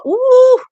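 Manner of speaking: high, loud "ooh" of outrage; exasperated ooh. Retold or imitated. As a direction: whoop it out in exasperation. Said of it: "I went off".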